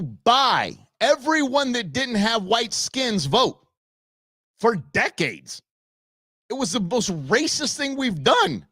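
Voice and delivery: high-pitched, slowed, heated rant; fired up rant. Real, not acted. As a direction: hammer each point, rising indignation. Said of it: "I'm getting a little fired up".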